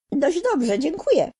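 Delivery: uprzejma dziewczynka, wyższym głosem; grzecznie. Acted. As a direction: grzeczna odpowiedź małej dziewczynki. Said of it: "odpowiedziała grzecznie Dorotka". (Dorotka grzecznie odpowiada strachowi, uprzejmie)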